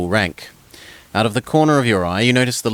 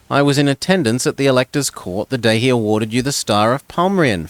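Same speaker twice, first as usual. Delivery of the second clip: noblewoman; 2 semitones higher than usual. Acted. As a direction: gush politely like a young baroness. Noblewoman praises the warrior.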